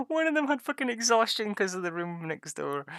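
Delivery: high, helpless laughing voice. Real, not acted. Player recalling a friend's exhaustion, laughing. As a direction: squeeze the words out through real laughter. Laughing over story of sleepless night.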